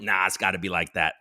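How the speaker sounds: usual speaking voice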